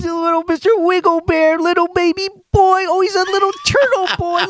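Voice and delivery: high cooing dad voice to his infant son; daddy baby talk. Shown as a demonstration. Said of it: "let's hear some daddy baby talk to him".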